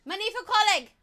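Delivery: high, squealed silly character voice; Tammy. Acted. Calling boyfriend over to be a dork, high squeaky begging. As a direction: squeak out the plea loudly. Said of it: "Hi, I'm Tammy".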